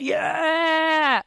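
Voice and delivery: long drawn-out cheer; hammed-up revved-up whoop; excited cheer. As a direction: hold the word out like a crowd cheer. Acts cheer for tent meeting.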